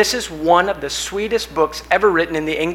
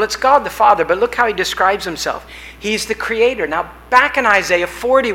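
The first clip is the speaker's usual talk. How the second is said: ordinary talk, nothing marked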